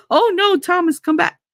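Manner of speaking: high, fluttery plea; mock pleading mother. Mocking mother begging Thomas to come back, high plea. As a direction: beg him to stay, hammed.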